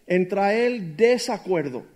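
ordinary delivery

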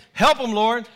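loud church response call; congregation call-out. Shown as a demonstration. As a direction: call it out to the preacher. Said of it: "This is between".

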